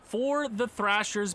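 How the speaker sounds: hammed-up announcer wrap-up; mock play-by-play. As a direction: announce the loss like TV commentary. Mock sportscaster call of the result.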